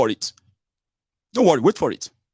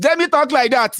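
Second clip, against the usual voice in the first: defiant retort; 11 semitones higher than usual. Real, not acted. Defiant reply to critic.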